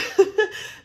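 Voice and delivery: high unvoiced giggle; breathy giggle. Real, not acted. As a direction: giggle with delight. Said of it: "oh my gosh".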